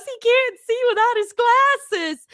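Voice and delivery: put-on high, slow, tearful little-girl voice; imitating a crying girl. Streamer retelling the My Girl scene, imitating her crying. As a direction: mimic her sobbing line from My Girl. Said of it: "she goes".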